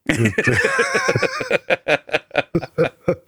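rising, helpless laughter; long laugh. Real, not acted. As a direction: lose it completely.

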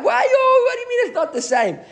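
high, loud outburst breaking into a laugh; hammed-up indignant shout; mock shout. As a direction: yell it in mock outrage, then laugh. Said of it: "So Resh Lakish shouted out".

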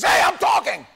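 loud, high, angry yell; angry shout. Retold or imitated. Husband in Bible study shouting his wife down, loud and angry. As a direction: bark it to shut her up. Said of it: "he turns around and goes".

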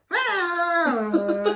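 high, loud sung ah; operatic vocal ah. Shown as a demonstration. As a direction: belt a dramatic ah. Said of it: "I'm more like".

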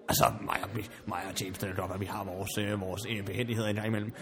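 low, soft, faltering explanation; subdued defence. Acted. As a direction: feel for the words quietly, voice sunk. Defensive about relationship with butler. (lavmælt, tøvende forsvar)

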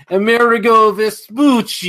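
hammed-up Italian accent, high and loud; terrible Italian accent. Shown as a demonstration. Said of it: "the really terrible Italian accent".

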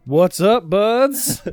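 loud, high stadium announcer call; baseball announcer voice. Acted. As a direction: boom it out like a ballpark announcer. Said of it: "You're gonna hit me with the baseball voice".

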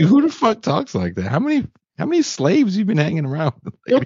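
high-pitched, amused disbelief; mock incredulous. Heckling the TikTok lady over her accent, mock incredulous. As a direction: squeal the challenge in mock outrage.

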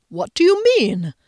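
high, loud, taken-aback question; jolted out of a daydream; startled question. A narrator's reading. Man interrupted in his reverie, startled question.

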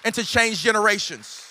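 loud, driving preacher's proclamation; fired-up declaration. Acted. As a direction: build into a forceful vow.

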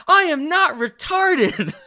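high, drawn-out mock protest; imitated indignant protest. Imitating the girlfriend objecting to an insult, indignant.

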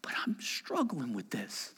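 hushed admission; whispered confession. Acted. A believer admitting a struggle to God in a whisper. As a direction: confess it under your breath.

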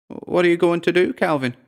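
high-pitched timid woman. A narrator's reading. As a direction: ask timidly in a high little voice. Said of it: "Dora asked timidly".

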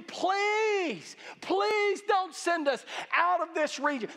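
high, drawn-out mock pleading. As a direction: act out demons begging to stay. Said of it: "they're pleading with you".

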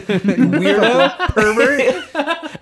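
high, drawn-out comic scolding; mock outrage. Dad scolds technician over his kids, mock outrage. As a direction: scold slowly, half laughing.

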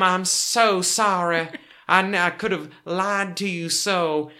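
high, slow, theatrical Southern belle apology; a swooning, drawn-out Southern belle sorry; refined Southern accent. Retold or imitated. Wife playing the fainting Southern belle apologizing to Tim. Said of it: "your accent is not a parody of what she's doing".

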